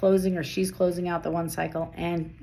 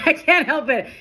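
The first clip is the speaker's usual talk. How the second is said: high, louder, giggling apology; laughing; 10 semitones higher, 5 dB louder than usual. Real, not acted. Reader laughing at her own rapid talk. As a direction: laugh it out helplessly.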